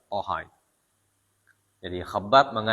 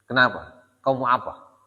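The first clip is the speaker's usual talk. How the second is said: sharp, louder defiant retort; defiant challenge; 3 semitones higher, 5 dB louder than usual. Retold or imitated. Sister defiantly answers her furious brother, sharp and louder. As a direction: throw the challenge back at him.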